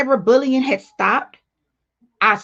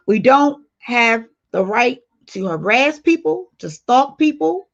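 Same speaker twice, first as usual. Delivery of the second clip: slow, deliberate, leaning on don't; slow emphasis. Real, not acted. Lecturing chat on harassment, slow and emphatic. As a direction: weigh each word, pressing don't.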